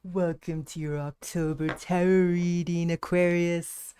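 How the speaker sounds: low, loud villain greeting; mad scientist. Acted. Spooky season greeting in villain voice.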